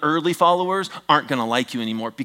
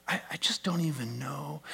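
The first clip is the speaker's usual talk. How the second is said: whispered confession; 11 dB quieter than usual. Real, not acted. Confiding aside to congregation.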